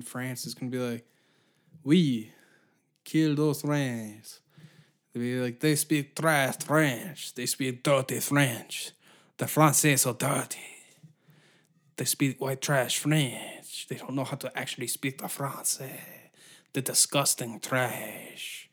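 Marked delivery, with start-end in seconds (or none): 1.8-4.4 put-on loud, drawn-out French accent, slightly raised; slow, exaggerated French accent; mock French accent. France voiced in a bit. 5.6-18.7 put-on slow, snooty French accent; drawn-out French accent, heavy with disdain; mock French accent. France mocking Quebec French.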